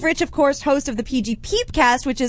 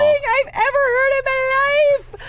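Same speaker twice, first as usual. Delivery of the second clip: gushing; 13 semitones higher than usual. Real, not acted. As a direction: gush like it melts your heart. Touched by a caller's loyalty.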